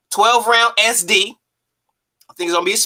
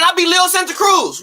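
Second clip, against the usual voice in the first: mock boast; 10 semitones higher than usual. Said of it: "That's like him saying".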